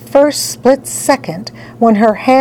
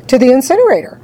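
usual speaking voice

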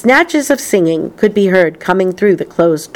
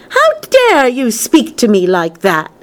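high, restrained outraged cry; indignant shout; 6 semitones higher than usual. A narrator's reading. Missus Salt scolds Wonka for insulting her, indignant shout. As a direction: take offence in a raised pitch. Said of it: "shouted Missus Salt".

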